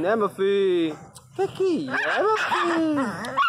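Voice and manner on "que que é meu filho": high, slow coo; baby talk. Acted. Asking his barking dog what's wrong, high cooing. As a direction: soothe the grumpy pup in baby voice.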